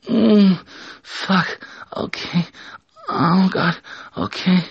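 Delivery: high-pitched acted moaning; high put-on moaning voice; loud moaning. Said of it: "moaning really loud".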